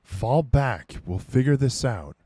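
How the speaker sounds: loud, high shouted command; screaming. Acted. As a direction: scream after a charging comrade to pull back. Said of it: "Adam screaming behind him".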